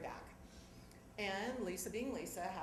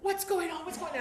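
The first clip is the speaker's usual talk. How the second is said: high, loud, panicky questioning; alarmed Lisa; 9 semitones higher, 6 dB louder than usual. Retold or imitated. Lisa panics at the girls screaming, high and loud. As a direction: sister bursting out in alarm. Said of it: "she's like".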